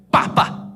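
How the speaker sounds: two sharp voiceless smacks; slap sounds. Retold or imitated. Father imitating the smacks of a spanking, sharp and voiceless. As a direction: click out two slaps.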